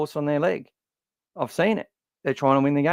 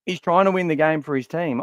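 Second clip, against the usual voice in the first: ordinary talk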